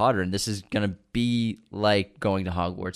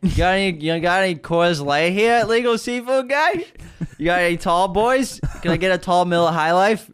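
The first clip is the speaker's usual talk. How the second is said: put-on Boston accent; 8 semitones higher, 5 dB louder than usual. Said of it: "Boston Aladdin".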